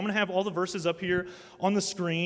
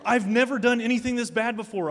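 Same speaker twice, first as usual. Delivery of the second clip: ordinary talk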